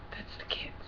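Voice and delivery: mock whisper, barely there. Vlogger echoing a pro-life politician's line, whispered. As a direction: echo it in a hushed whisper. Same words repeated in whisper.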